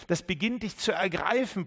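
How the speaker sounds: normal talking voice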